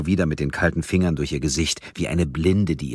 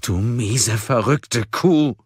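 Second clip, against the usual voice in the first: furious insult; 8 semitones higher, 5 dB louder, much slower than usual. Acted. Bound Mia explodes in rage.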